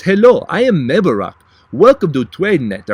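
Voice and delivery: loud, put-on French accent, pitch rising and falling; mock French accent. Vlogger mimicking the founder from the commercials, mock French accent. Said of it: "talks like this from France".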